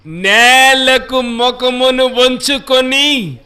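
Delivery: slow, loud, drawn-out reading of the verse; solemn scripture reading. Acted. Preacher reading the verse slowly and loudly to his congregation. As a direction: proclaim each word slowly to the church.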